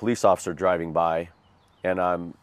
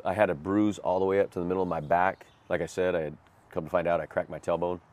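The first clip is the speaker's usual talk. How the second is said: ordinary talk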